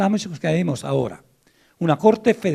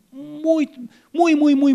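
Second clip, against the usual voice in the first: each "muy" jumps much higher, drawn out for emphasis; emphatic repetition; 11 semitones higher, slower than usual. Real, not acted. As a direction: pile up "muy, muy, muy" with rising insistence. Emphasising how complex the system is. (énfasis en cada "muy", mucho más agudo)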